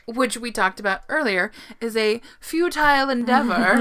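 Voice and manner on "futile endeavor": hammed-up character voice, higher pitched. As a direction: say it goofily on purpose. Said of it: "in a character voice".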